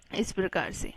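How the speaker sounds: ordinary delivery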